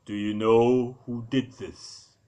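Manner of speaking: a lightly deepened, slow voice from the dark; slow question out of the shadows, barely put on; hint of a deep stranger in the darkness. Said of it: "A deep voice in the darkness said".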